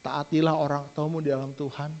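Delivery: ordinary talk, nothing marked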